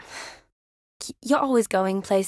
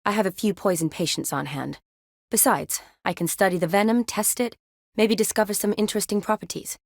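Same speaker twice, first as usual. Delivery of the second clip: ordinary talk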